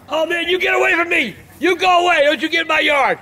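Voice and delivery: high, panicked shouts to drive him off; high-pitched frightened go away; scared yelling. Acted. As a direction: yell him out of your yard in a fright. Scared of the visitor at the yard.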